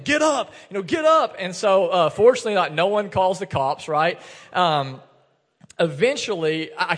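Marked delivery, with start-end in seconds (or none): 0.0-1.4 higher, louder pleading shout; father urging his crying daughter up off the grass, raised pleading shout; urgent shout. Retold or imitated. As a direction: call out to get her moving. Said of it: "to this man standing over saying".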